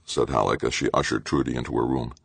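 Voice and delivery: plain reading voice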